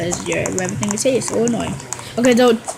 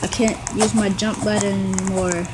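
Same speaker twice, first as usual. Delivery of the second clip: ordinary talk, nothing marked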